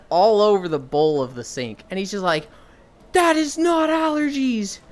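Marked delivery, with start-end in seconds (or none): 3.1-4.8 slow, high-pitched shouted disbelief; drawn-out mock panic, pitched way up; imitated alarmed coach. Coach seeing blood all over the sink, mock-horrified.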